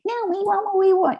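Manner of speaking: high, quick mock kid demanding; a lightly hammed-up bratty child voice; kids whining demand. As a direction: mimic the twins insisting, squeaky and pushy. Twins demanding, ganging up on mom.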